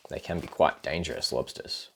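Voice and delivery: soft, quick aside; hushed warning. Real, not acted. Warning the child about lobsters, softly. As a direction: murmur a little warning.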